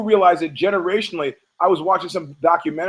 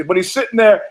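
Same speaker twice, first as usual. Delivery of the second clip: ordinary talk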